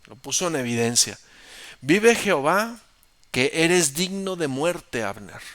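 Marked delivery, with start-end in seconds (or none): 1.8-5.4 high, loud, slow oath; solemn oath shouted. Acted. David swearing against Abner across the valley, loud. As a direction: swear it like a judgment.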